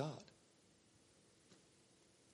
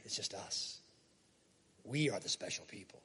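whispered, lower smug aside; 6 semitones lower, 7 dB quieter than usual. Acted. Preacher voicing Israel smugly keeping God to themselves, whispered. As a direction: hush it like a self-satisfied secret.

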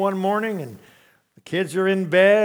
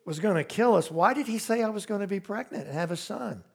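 usual speaking voice

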